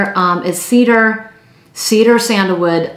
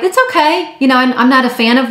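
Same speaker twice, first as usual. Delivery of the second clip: much higher, mock lukewarm shrug of a voice; a hammed-up "meh, it's okay"; unimpressed coworker; 6 semitones higher, faster than usual. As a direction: play a coworker who's barely impressed. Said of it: "Some were like".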